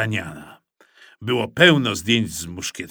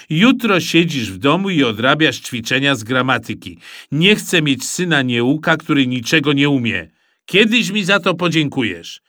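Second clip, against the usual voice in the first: louder firm fatherly scolding; dad scolds lazy son, firm and louder; stern father lecture; 2 semitones higher, 5 dB louder than usual. Acted. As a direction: lay down the law.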